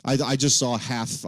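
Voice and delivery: ordinary talk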